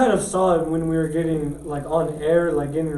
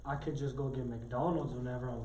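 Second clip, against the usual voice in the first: deadpan aside; 4 semitones lower, 17 dB quieter than usual. Pretend.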